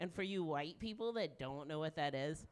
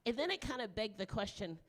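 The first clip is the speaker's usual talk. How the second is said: ordinary talk, nothing marked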